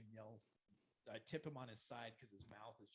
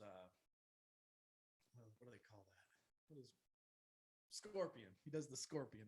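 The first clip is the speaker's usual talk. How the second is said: ordinary talk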